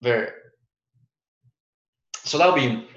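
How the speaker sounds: ordinary talk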